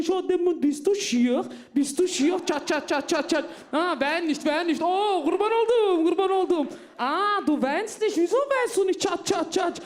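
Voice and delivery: put-on high, gushing auntie voice fussing over a baby; doting Turkish auntie. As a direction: coo and pinch cheeks like an overexcited aunt. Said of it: "so wurde ich aufgewachsen".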